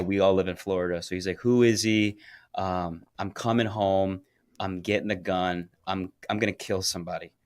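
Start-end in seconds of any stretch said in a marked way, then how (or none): none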